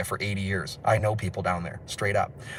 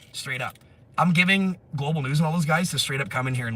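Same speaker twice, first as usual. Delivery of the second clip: normal talking voice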